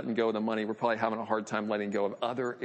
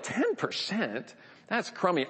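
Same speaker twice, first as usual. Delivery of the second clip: high-pitched mock disdain, drawing out the first words; scoffing shopper; 6 semitones higher than usual. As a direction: scoff like a bargain hunter unimpressed by the discount. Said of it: "she scoffs at that".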